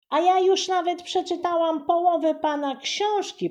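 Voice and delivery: put-on high, loud bragging child; proud little girl boasting loudly. A narrator's reading. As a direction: show off to the author. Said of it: "pochwaliła się Buba".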